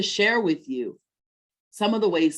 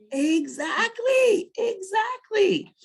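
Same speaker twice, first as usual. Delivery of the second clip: drawn-out excited agreement; much slower than usual. Real, not acted. Mother enthusiastically agreeing with daughter.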